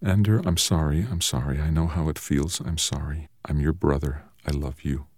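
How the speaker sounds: very soft, hushed confession; whispered apology. A narrator's reading. Brother apologizes by Ender's bed at night, whispered. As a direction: murmur it barely audibly to a sleeper. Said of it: "He whispered".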